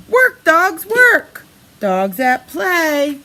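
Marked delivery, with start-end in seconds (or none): 0.1-1.4 higher, louder urging; calling out. Acted. Reading to a child, urging the book's dogs to work, loud and high.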